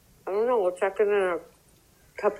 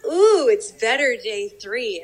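bigger, louder, drawn-out ooh; slow, savoring mock amazement; growing retold delight; 3 semitones higher, 5 dB louder, slower than usual. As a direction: top the last ooh with more delight. Retold delight growing each day.

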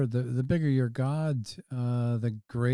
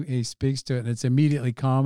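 usual speaking voice

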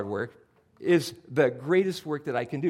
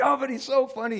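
hooked on the joke; 9 semitones higher than usual. Retold or imitated. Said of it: "I'm going".

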